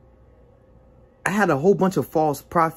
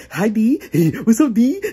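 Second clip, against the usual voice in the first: high, loud greeting broken by cackles; demon-possessed DJ; 8 semitones higher, 5 dB louder than usual. Retold or imitated. Possessed DJ gets up in his friend's face, manic. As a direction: come at him higher and louder each time, cackling.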